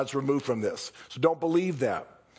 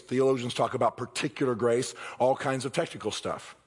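ordinary delivery

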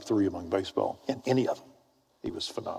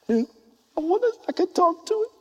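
very high, tiny pleading voice; squeaky, shy wondering; timid seeker voice. Acted. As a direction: play an awestruck kid daring to ask. Voices timid seeker approaching Christ.

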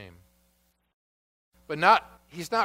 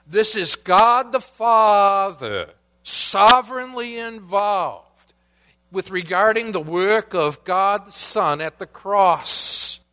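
slow, emphatic pulpit preaching, higher and louder; full-force preaching; 6 semitones higher, 5 dB louder, slower than usual. Acted. Preacher driving home God's role at the cross, slow and emphatic. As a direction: lean into it like a preacher.

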